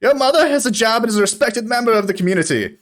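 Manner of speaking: fast, high snappy comeback; quick retort. Acted. Old dwarf retorting to a heckler, fast and high. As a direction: fire back at the heckler. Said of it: "to which he retorts".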